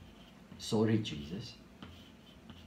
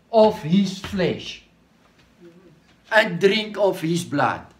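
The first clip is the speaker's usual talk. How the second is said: high, loud, impassioned preaching; preaching at full force; 8 semitones higher, 5 dB louder than usual. Acted. Preacher exhorting the congregation at full force. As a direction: lift the voice and drive each phrase home.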